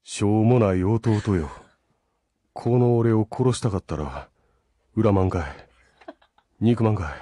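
put-on low, slow, brooding older brother in Kansai dialect; dark anime villain speaking slow Kansai dialect; Itachi in Kansai dialect. Shown as a demonstration. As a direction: deliver it low and drawn out in Kansai-ben, with long pauses. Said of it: "イタチの声で関西弁を喋ってほしい".

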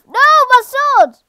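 high, loud cry of dismay; dismayed shout. Real, not acted. Player losing a weapon mid-fight, high dismayed shout. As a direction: yell out at a sudden loss. Loses sword in game fight.

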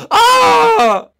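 high piercing scream; sudden terrified shriek. Acted. Mario attacked.

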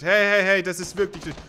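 sudden loud high hey hey; startled yelling; panicked shout. Real, not acted. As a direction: yell in alarm.

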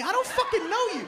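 high, indignant comic outburst; exasperated waiter. Acted. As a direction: snap back at a false friend. Waiter rejects overfamiliar customer.

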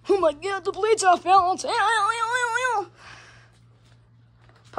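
high-pitched mock alarm, wailing the ows; mock panic. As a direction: wail it in pretend distress. Hammed-up panic over wobbling blade.

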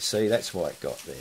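ordinary talk, nothing marked

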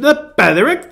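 feeble high British accent on The Petherick. Shown as a demonstration. Pastor trying out a British accent on a channel name. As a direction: put on a posh-ish British lilt, badly. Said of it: "very feeble attempt at a British accent".

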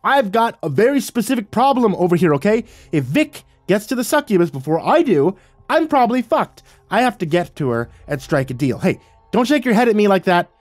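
high, loud, exasperated rant; yelling. A narrator's reading. Narrator protesting to Falling Sky about the succubus, yelling. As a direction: yell in frantic protest, pitch way up. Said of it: "threw my wings in the air and yelled".

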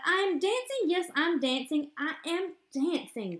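high, bright shout of joy; joyful cry. Acted. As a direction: burst out delighted, climbing high. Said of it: "Gerald cried".

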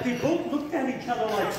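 ordinary delivery, nothing marked

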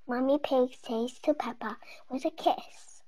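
plain reading voice

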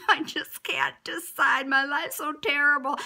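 slow, whiny mock despair; mock despairing JoJo. JoJo unable to hand out roses, slow mock whine. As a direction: ham up a spoiled girl's lament, dragging it out. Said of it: "she started going".

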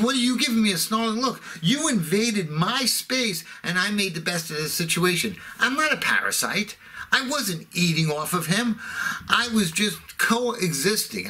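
high-pitched, aggrieved comic outburst; hurt, indignant mock rant; indignant protest. Indignant retort to snarling seatmate.

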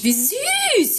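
high squeal of delight; gushing. Real, not acted. Streamer touched by a viewer's compliment, high gushing.

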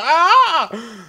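high, loud imitation yell; mock panic scream. Gamer acting out Vito's panic attack, mock scream. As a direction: let out a pretend panicked scream. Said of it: "just like".

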